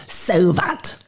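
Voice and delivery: "that" said as "vat", for "what"; accented pronunciation. A narrator's reading. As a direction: say "that" with a v at the start and a hard t: "vat". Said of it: "He pronounces it that".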